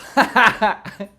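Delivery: high, loud cackle; loud burst of laughter. Real, not acted. Streamer cracking up at his own joke, loud laugh.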